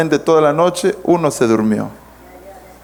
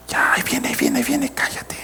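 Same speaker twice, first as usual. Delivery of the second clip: furtive near-whisper, fading away; hushed warning; 10 semitones higher, 7 dB quieter, faster than usual. Acted. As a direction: hush someone urgently as a person approaches.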